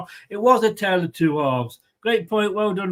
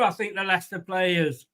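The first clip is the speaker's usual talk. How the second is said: ordinary talk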